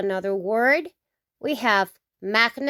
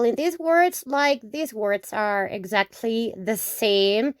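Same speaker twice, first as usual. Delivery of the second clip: usual speaking voice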